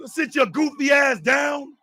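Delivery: ordinary talk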